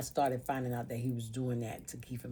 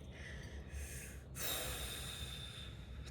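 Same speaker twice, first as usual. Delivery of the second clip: long unvoiced sigh; heavy sigh; 12 dB quieter than usual. Real, not acted. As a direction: let out a tired sigh. Long sigh before emotional part.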